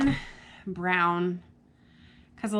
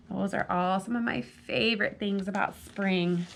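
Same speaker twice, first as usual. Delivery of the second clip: ordinary talk, nothing marked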